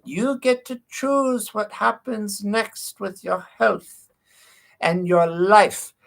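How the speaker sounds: imitated old doctor, high and very slow Eastern European accent. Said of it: "kind of Eastern European accent".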